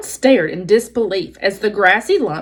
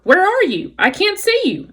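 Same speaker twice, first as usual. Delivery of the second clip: frog croak; 10 semitones higher than usual. Acted. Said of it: "One would croak".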